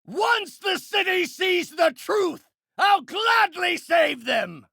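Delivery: forceful declamatory shout; villainous proclamation. Acted. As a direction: shout it as the city's self-appointed saviour.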